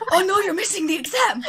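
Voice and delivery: high, loud mock panic through laughter; puffed-up lizard. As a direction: squeak it out all puffed up and laughing. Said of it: "he poofs up and he goes".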